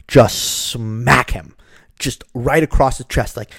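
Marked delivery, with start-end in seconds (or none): none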